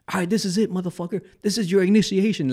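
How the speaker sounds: imitated gang challenge. Gang member announces his initiation, imitated challenge.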